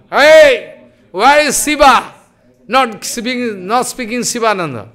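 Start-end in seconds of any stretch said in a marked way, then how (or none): 0.1-2.1 loud, higher, demanding shout for Shiva; angry hungry call. Acted. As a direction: bellow for the missing cook, irritated. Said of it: "Avadut Nityananda feeling angry".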